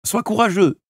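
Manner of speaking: usual speaking voice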